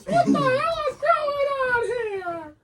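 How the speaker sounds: very high, slow panicked cry; panicked shout. Pretend. Voicing startled people.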